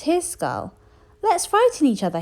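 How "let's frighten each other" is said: high, loud bright suggestion; little skeleton's idea. A narrator's reading. Little skeleton suggests frightening each other, high and loud. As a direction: propose the game eagerly. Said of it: "he said".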